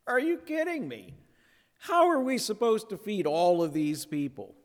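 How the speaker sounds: hammed-up are-you-kidding-me; imitating the disciples' incredulity; incredulous disciples. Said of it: "the disciples are just".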